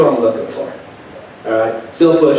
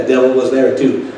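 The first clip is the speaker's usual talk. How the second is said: ordinary delivery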